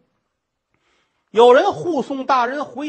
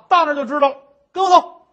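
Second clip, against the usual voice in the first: gruff order; 4 semitones higher, 6 dB louder, faster than usual. Acted. Guard orders the nun.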